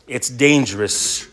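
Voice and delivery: usual speaking voice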